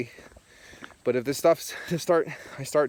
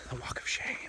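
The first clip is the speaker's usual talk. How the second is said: breathy whisper of a joke; 11 dB quieter than usual. Real, not acted. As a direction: whisper it like a guilty secret. Joke punchline dropped to whisper.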